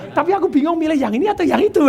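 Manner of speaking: high-pitched, put-on indecisive wife; feminine. Wife unsure which bag to choose, feminine. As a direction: whine it coyly in a mock feminine voice. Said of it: "Pakai feminin".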